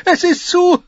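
very high, loud gasps between laughs; helpless laughter. A narrator's reading. Tyrion telling his sister it's too funny, helplessly laughing. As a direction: force out words between fits of giggles. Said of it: "einige Worte hervorzustoßen".

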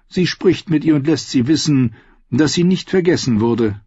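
plain reading voice

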